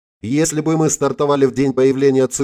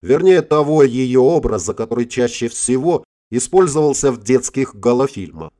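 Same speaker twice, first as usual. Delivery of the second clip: ordinary delivery, nothing marked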